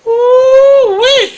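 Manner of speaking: a mock gleeful ooh-wee; mock gleeful squeal. Mocking a woman's glee over child support, high squealing ooh-wee.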